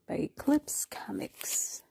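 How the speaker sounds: breathy whispered aside; whispering. Real, not acted. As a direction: whisper it to yourself.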